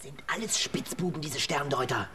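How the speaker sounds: breathy hush, barely voiced; conspiratorial whisper. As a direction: whisper so no one overhears. Diebe tuscheln heimlich. (verschwörerisch geflüstert)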